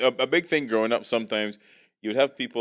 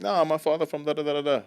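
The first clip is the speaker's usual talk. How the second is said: put-on higher, Caribbean-accented patter; switched Caribbean accent; 7 semitones higher than usual. Friend revealing his father's roots, sudden Caribbean accent. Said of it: "it was straight American".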